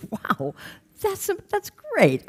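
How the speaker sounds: high, slow, delighted reaction; amazed delight. Retold or imitated. Group leader hearing Ronnie is happy, acted high delight. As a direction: draw it out high in retold amazement.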